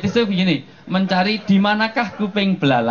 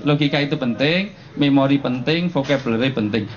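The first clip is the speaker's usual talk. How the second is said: ordinary talk, nothing marked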